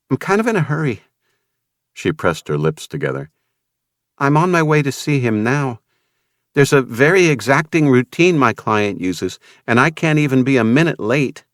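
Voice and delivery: lightly voiced young woman; Vanessa's voice. A narrator's reading.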